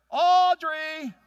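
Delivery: high, loud comic shriek for his wife; mock panicked yell. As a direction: scream the wife's name in fake terror. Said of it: "I go".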